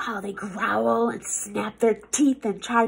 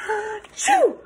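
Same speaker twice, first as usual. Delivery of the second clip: big sneeze; 9 semitones higher than usual. Acted. Monster sneezes again.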